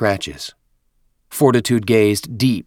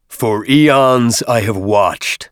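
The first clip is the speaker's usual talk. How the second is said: slow, loud, echoing oracle voice; deep reverberating voice; 2 semitones higher, 6 dB louder, much slower than usual. Acted. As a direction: boom it out like an ancient machine. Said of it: "The oracle's deep voice reverberated".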